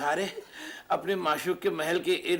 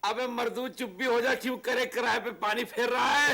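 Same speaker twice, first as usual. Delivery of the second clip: exasperated scolding; 7 semitones higher, faster than usual. Acted. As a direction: shut him up before he ruins it. Scolds him for spoiling the plan.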